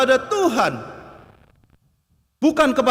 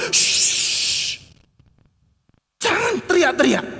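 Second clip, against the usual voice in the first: hushing him; 5 semitones higher, slower than usual. Acted. Crowd hushing the blind man.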